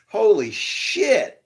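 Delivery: floored by what he sees; stunned exclamation. Real, not acted. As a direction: let it out slowly in disbelief. Shocked by the changed letter.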